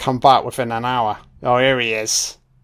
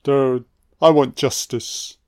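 put-on somber child; slower than usual. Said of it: "Very somber, going".

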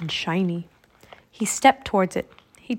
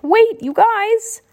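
high, loud, drawn-out call; stretched, high-pitched raven cry of panic; panicked croak; 12 semitones higher, 6 dB louder, slower than usual. A narrator's reading. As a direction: call after departing friends in alarm. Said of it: "Jack was in a panic".

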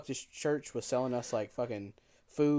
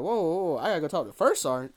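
alarmed; 5 semitones higher, 7 dB louder than usual. Retold or imitated. Alarmed at having to see First Sergeant.

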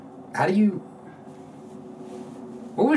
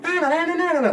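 much higher squeaky na-na babble; silly high babble; 4 dB louder than usual. Retold or imitated. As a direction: chirp the nonsense syllables way up high. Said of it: "the high voice is significant of another person".

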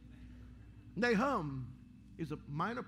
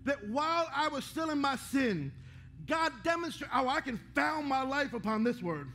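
preaching at full force; 6 semitones higher than usual. Acted. Preacher rousing the congregation to build on God, full-force preaching. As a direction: preach it out, rising and pressing hard.

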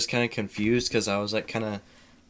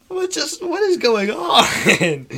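high, slow, laughing bewilderment; startled girlfriend; 7 dB louder, slower than usual. Retold or imitated. Girlfriend hears him talking awake at midnight, high startled squeak. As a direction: squeak it out in disbelief.

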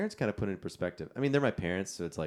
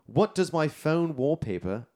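slow, higher put-on British accent; British accent, raised and drawn out; 4 semitones higher, 4 dB louder than usual. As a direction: ask it in a deliberate mock British voice. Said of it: "it's a British accent".

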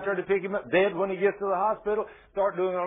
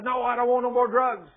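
higher-pitched, mock upset protest; upset woman; 5 semitones higher, 4 dB louder than usual. Upset woman turning down the man's drugs, raised mock protest. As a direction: imitate an upset woman refusing. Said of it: "she's all upset".

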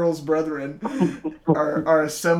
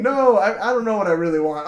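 ordinary talk, nothing marked

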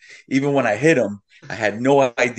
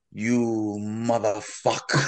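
long drawn-out groaned curse, quieter; cursing victim; 3 semitones lower, 5 dB quieter, much slower than usual. Retold or imitated. Delivery guy on the floor to driver, slow groaned cursing. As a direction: imitate an injured man cursing slowly under his breath. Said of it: "he keeps saying".

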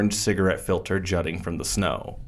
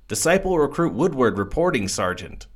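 higher, eager soldier voice; eager young recruit; 6 semitones higher than usual. A narrator's reading. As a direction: report in like a keen new recruit.

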